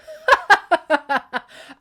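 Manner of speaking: loud, high cackling crow of laughter. A narrator's reading. Demeter laughing at the thought of revenge, crowing cackle. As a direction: cackle in bitter triumph. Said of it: "burst into crows of laughter".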